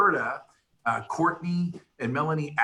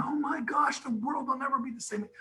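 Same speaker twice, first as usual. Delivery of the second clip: much higher, softer mock gasp of alarm; 11 semitones higher, 5 dB quieter than usual. Speaker mimics frightened listeners hearing the news, higher mock alarm. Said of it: "some of you are going".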